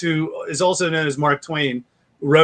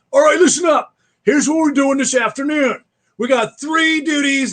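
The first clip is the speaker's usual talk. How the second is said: Marine leader voice; 10 semitones higher than usual. Retold or imitated. Dad ordering his kids around, drill leader barking commands. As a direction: bark it out like you're briefing troops. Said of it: "I have a tendency to blade hand".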